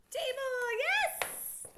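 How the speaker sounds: high, loud cheer of yes; excited praise. Real, not acted. Teacher cheering a student's correct reading, high excited praise. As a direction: celebrate the right answer out loud.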